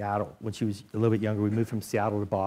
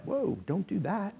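a mock-cautious, slow higher voice of his own doubts; cautious inner voice; 8 semitones higher, 4 dB quieter, slower than usual. His inner voice warning him not to quit, mock cautious. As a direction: voice the nervous side of your brain, pulling back.

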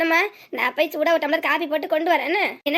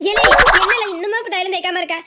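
loud scolding call across the house; sharp village-aunt shout; 2 semitones higher, 6 dB louder than usual. Acted. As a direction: bark at him to get on with it. Aunt scolds him over balm.

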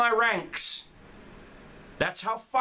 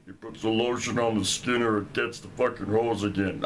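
much lower, creepy menacing villain voice; Buffalo Bill; 10 semitones lower than usual. Retold or imitated. Imagining the admin as a horror villain, low creepy voice. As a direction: growl it like the pit captor. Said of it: "it's gonna be Buffalo Bill's voice".

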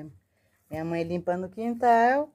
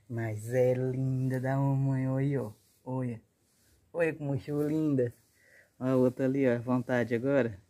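tender cooing; 5 semitones lower, 4 dB quieter than usual. Real, not acted. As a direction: coo lovingly at a pet. Cooing over her pet tortoise.

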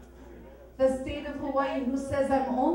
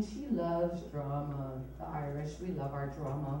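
hushed theatrical aside; hushed dramatic; 8 semitones lower, 7 dB quieter than usual. Acted. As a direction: drop low and quiet for drama. Mock-solemn aside about Irish drama.

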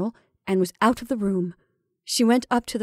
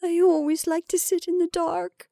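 high, thin, choked voice; choking reply; 9 semitones higher than usual. A narrator's reading. Rebecca excusing herself before weeping, chokingly. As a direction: answer as if holding back tears, pitched high. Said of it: "replied Rebecca chokingly".

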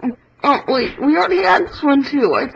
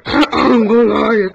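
lower, slow booming giant; 7 semitones lower, 3 dB louder, slower than usual. Pretend. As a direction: boom it slowly like a giant. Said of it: "One hundred impressions".

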